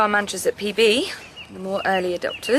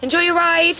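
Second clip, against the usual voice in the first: calling after; 9 semitones higher, 7 dB louder than usual. Acted. Calling after departing rider.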